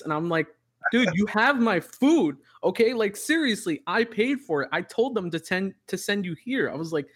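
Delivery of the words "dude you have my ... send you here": retold argument with the delivery guy, high and exasperated; exasperated retold yell. Retelling heated argument with driver.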